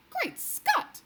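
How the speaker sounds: a startled royal cry; cried out. A narrator's reading. The queen crying out in shock at the headline. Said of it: "cried the famous voice".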